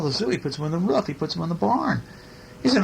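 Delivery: usual speaking voice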